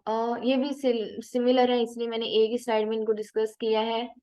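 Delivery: normal talking voice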